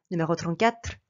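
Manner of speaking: ordinary delivery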